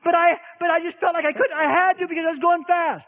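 mock upset wife; pleading wife.

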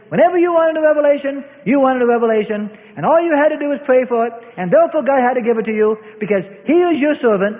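high, loud, fast preaching; preacher rising to a pitch; fiery preaching. Acted. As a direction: drive it home at full force. Preaching builds.

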